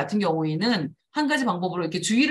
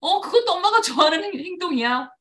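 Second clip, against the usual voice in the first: put-on child's thought; 9 semitones higher than usual. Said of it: "이렇게 생각하는 거예요".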